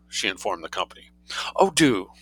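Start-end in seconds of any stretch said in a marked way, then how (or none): 1.6-2.1 higher, earnest urging; a short, eager request. A narrator's reading. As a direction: urge them on sincerely. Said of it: "said Mavis Pellington earnestly".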